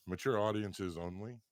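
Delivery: normal talking voice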